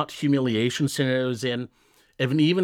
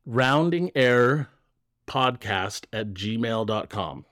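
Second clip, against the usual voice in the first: slow, measured email dictation; dictating an address; slower than usual. Real, not acted. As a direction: spell it out slowly for listeners to note down.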